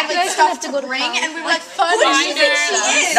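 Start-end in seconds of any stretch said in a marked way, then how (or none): 1.8-3.2 louder, sniffy mock outrage; mock indignant. Student imitating classmates sniffing at the new teacher, mock indignant. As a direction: play up old snobbery as a joke. Mocking their early attitude to teacher.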